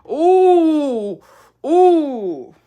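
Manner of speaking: long drawn, loud ooh; loud teasing ooh of a threat; menacing ooh. Real, not acted. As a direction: savour the threat. Threatening anticipation.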